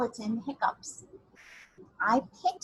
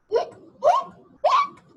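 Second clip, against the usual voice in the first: hiccups; 10 dB louder than usual. Pretend. Hiccups with laughter.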